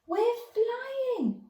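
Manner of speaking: high, joyful whoop. Acted. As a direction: whoop with delight as they rise. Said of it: "whooped George".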